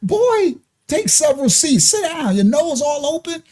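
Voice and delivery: high-pitched mock scold telling him to sit down; scolding dismissal. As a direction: shoo the lovesick boy back to his seat. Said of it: "I'm like".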